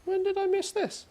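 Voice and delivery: very high astonished squeal; surprised squeak. Real, not acted. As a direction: squeal it in disbelief. Surprised by news post.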